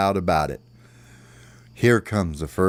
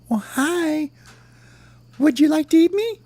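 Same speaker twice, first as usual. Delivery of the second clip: very high squeaky character voice; little worm voice. Acted. Little worm offers himself to the bird, tiny high voice. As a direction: pipe up as a tiny worm, in falsetto.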